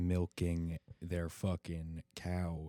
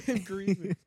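laughing quote; 10 semitones higher, 5 dB louder than usual. Pretend. Repeats line laughing.

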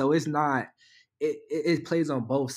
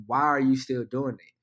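ordinary talk, nothing marked

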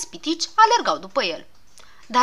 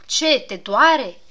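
surprised; 3 semitones higher, 7 dB louder, much slower than usual. Acted. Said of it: "se miră Pilulă".

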